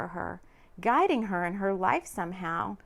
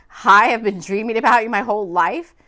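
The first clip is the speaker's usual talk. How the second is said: awkward imagined greeting; 10 dB louder than usual. Pretend. Imagined greeting to dream man.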